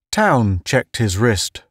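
usual speaking voice

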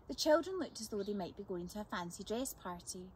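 ordinary delivery, nothing marked

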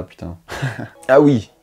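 normal talking voice

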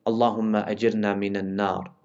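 soft, low Arabic supplication; quiet prayer. Acted. Preacher asks refuge from the fire with a soft prayer. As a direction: murmur the plea for protection. Closing supplication repeated twice.

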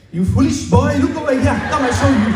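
loud, high, hammed-up teacher voice; mock teacher shout. Teacher calling the confused student over, loud mock scolding. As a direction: boom it like a stern teacher beckoning. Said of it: "He's like".

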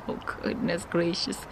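ordinary talk

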